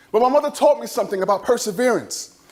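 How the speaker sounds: ordinary talk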